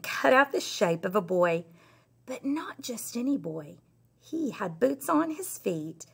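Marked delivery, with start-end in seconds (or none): 2.3-3.8 hushed teasing whisper. A narrator's reading. Teacher whispering a story aside to her class, hushed. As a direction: lean in and whisper the secret.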